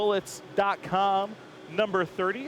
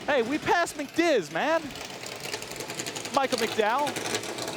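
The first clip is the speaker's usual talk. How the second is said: excited spotting; 6 semitones higher, much slower than usual. Real, not acted. Spotting a driver walking by.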